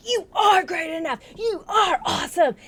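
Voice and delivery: high, loud self-hype shout; imitating a screamed pep talk. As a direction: yell it like hyping yourself up in the car. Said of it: "just screaming inside of his car".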